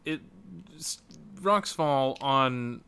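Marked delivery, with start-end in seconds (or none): none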